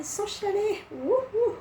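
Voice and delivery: high sarcastic whoop; ironic cheer. Real, not acted. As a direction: cheer mockingly at yourself. Ironic cheer while near tears.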